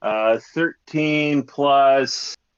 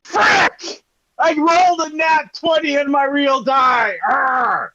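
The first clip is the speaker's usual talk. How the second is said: frustrated shout; 13 semitones higher, 4 dB louder than usual. Real, not acted. Nat twenty wasted on intimidation.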